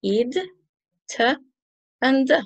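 teacher modelling "Id" as a syllable, "t" and "d" as bare consonants; ED endings demonstrated. Shown as a demonstration. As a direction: sound out "Id", "t", "d" separately, each isolated by a pause. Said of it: "three ways to pronounce E D".

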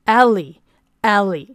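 slow, careful Aramaic Eli Eli; Aramaic pronunciation. Shown as a demonstration. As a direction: spell out each syllable of Eli slowly. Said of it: "所以我教大家念一次啊". (放慢念阿拉姆语的Eli，Eli)

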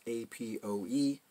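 A P O E said letter by letter; name each letter in A P O E separately; spelled-out letters. Shown as a demonstration. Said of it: "I've referred to this as".

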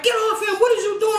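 high mock shout of the brother; brother yelling. Brother pulling her off the boy, high shout. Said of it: "My brother come grab me, like".

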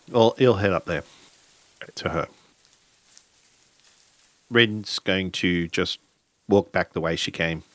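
ordinary talk, nothing marked

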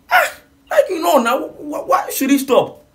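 why would he stop, in high-pitched shock; incredulous outcry. Real, not acted. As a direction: squeal out the disbelief. Incredulous at the quitter.

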